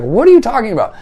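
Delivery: high, loud incredulous question; affronted mock astonishment; retold bafflement. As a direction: mimic her utter disbelief, voice shooting up. Retold woman baffled by the compliment.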